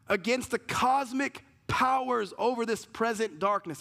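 full-voiced sermon preaching; preaching at full force. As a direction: drive the scripture home to the church.

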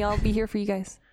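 ordinary delivery

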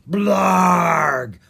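loud, drawn-out monster roar; monster blarg. Acted. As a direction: bellow it like a slimy beast. Monster roars.

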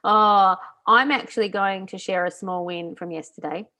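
ordinary delivery

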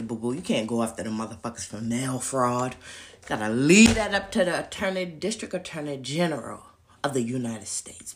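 low, condescending mock official voice. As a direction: talk down to him like a patronising clerk. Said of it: "basically they say".

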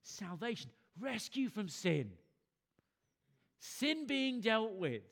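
loud, high, drawn-out preacher's cry. Acted. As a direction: proclaim it out loud, slowing and building in force. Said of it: "cried out in a loud voice".

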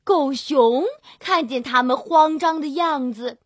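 ordinary delivery

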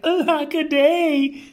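high wail of woe; theatrical high-pitched lament; dismayed cry. A narrator's reading. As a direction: wail it high, storybook style. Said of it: "And he cried".

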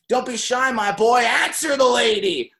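hammed-up tough guy egging on a shy kid; tough hockey idol. Idol urges shy teen, retold.

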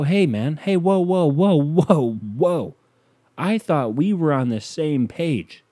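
high, pleading whoa whoa; mock defensive. Pushing back at girlfriend's mother, mock defensive. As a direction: hold up your hands and back off, hammed-up. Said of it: "And I go".

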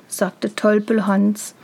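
plain reading voice

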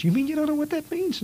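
put-on high, amazed boy's voice; incredulous kid. Know-it-all kid mocking a friend's ignorance, high and incredulous. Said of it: "Flick says".

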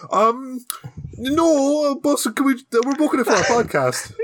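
high awkward answer; mock sheepish reply. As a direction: podcasters asking the priest, high and sheepish.

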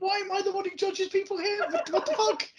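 high-pitched indignant protest; mock outrage. Real, not acted. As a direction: squawk in baffled protest. Protests being named the judge.

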